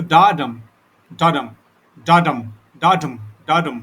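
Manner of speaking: "डाडम" beat out loudly, each foot falling in pitch; loud, evenly repeated "डाडम" rhythm demonstration; metrical foot demo. Shown as a demonstration. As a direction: chant "डाडम" as separate, punchy two-beat feet with pauses between. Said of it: "डाडम को जब आप पढ़ेंगे".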